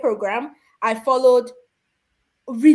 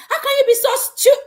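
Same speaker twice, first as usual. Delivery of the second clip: laughing mock outburst, higher and louder; half-laughed pretend yell, much higher and louder; mock scolding yell; 13 semitones higher, 6 dB louder than usual. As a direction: blurt it like an exasperated mother, then cut it off mid-word. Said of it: "Even if you are already saying".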